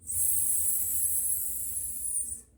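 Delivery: "S" as a long soft hiss; letter sound. Shown as a demonstration. Teacher showing the S sound, "S" as drawn-out hiss. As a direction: hold "S" as a steady voiceless "sss". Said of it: "Make the S sound".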